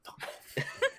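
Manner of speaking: high, laughing outburst; mock outrage. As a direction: blurt it out laughing, mock-offended. Mock outrage at being called Australian.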